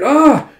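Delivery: high, loud "ah" of someone hit; mock cry of pain, sudden; acted pain cry. Soldier struck by an arrow, acted yelp.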